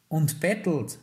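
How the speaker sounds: cutting in. A narrator's reading. Father interrupts his son's defence of Willi, cutting in. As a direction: cut in over the other speaker. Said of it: "rief sein Vater ihm ins Wort".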